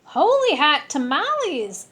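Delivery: loud, high, delighted outburst; excited exclamation. Acted. Kitten racing past his reflection, loud and thrilled. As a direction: shout it as he flies past the mirror. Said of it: "exclaimed Skippy John Jones".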